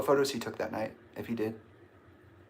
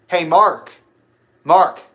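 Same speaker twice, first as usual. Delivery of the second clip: loud raised call after someone; 5 semitones higher, 12 dB louder, slower than usual. Retold or imitated. Calling after Mark as he keeps walking, hollering. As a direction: holler the name, higher and louder. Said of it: "And I was like".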